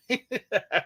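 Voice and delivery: light chuckle; a quick amused laugh. Real, not acted. Laughs at own pirate bit.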